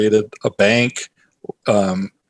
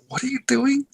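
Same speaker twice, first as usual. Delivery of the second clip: much higher, half-mocking disbelief; incredulous reaction; 11 semitones higher, 3 dB louder than usual. Nephew reacting to his uncle greeting customers, incredulous. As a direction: squeak out your shock at the founder greeting customers. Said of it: "I was almost like".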